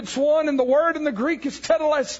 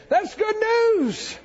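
joyful exclamation; 9 semitones higher, much slower than usual. Acted. Preacher's exclamation.

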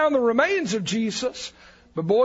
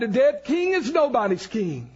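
ordinary delivery

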